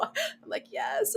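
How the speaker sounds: whisper. Retold or imitated. Dazed reply at airport.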